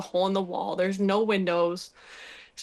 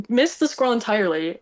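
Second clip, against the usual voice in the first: ordinary delivery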